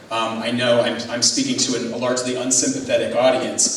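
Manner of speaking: ordinary delivery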